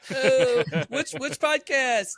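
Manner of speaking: high, slow, giggling question; laughing nervous question. Pretend. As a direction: play dumb through a laugh. Acting out the nervous reply.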